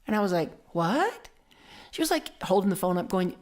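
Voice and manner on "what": baffled. Retold or imitated. Retold baffled reply to accusation.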